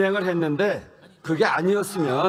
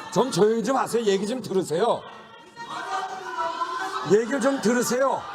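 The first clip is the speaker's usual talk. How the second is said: tag; 7 semitones higher than usual. Real, not acted. Chair calling noisy members to order.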